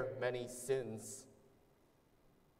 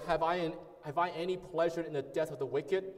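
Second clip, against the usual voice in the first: louder, rising preaching voice pleading with sinners; forceful scripture reading; 3 semitones higher, 4 dB louder than usual. Acted. Preacher urging the congregation to turn back, forceful proclamation. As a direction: proclaim it like the Lord calling out.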